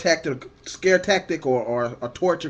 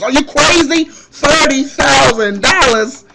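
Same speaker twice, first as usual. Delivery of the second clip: loud, high, slow mock outrage; outraged disbelief; 10 semitones higher, 10 dB louder than usual. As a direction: yell it back in disbelief. Ranting at the officer.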